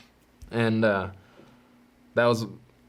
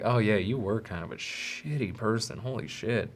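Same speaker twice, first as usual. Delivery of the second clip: self-reproach; 6 dB quieter than usual. Retold or imitated. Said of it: "the more I'm like".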